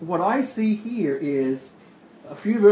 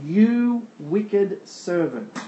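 very slow, measured rebuke; mildly stern, drawn-out condemnation; angry master; 3 semitones higher, much slower than usual. Acted. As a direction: draw out each word as a master's reproach. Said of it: "his master was angry".

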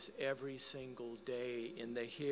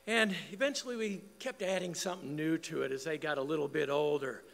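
normal talking voice

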